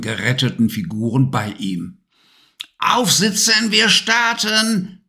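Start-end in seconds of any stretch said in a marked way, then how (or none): 2.8-5.0 high, slow witch voice giving orders; commanding witch. Acted. Witch ordering Levi onto the broom, high commanding call. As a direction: bark the order like a witch captain. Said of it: "kommandierte sie".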